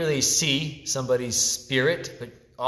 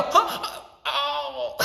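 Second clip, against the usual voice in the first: high, breathy half-laughed yelp; a failed timid yell; feeble laughing yelp; 3 dB louder than usual. Retold or imitated. Teacher mocking a student's failed timid yell. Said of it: "I'm gonna yell".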